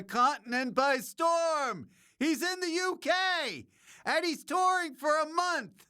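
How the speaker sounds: hyped-up announcing. Real, not acted. Host hypes the touring comic to listeners, hyped-up announcing.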